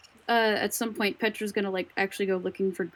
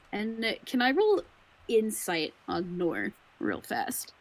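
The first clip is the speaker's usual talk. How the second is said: ordinary talk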